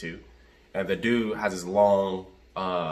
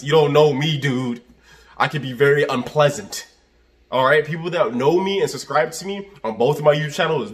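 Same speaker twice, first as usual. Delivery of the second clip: louder, higher, a firm warning with a laugh at the start; heated telling-off; 5 semitones higher, 6 dB louder than usual. Retold or imitated. Driver retelling how he warned the rude passenger, heated and loud. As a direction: let him know you won't be pushed around.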